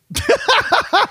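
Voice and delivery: squeaky high-pitched giggle; a sudden shrill giggle. Acted. Laugh after mock question.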